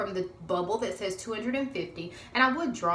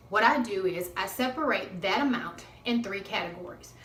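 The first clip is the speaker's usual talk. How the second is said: ordinary talk, nothing marked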